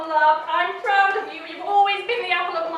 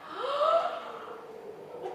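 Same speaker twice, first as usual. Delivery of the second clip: shocked gasp; 8 semitones higher, 8 dB quieter than usual. Acted.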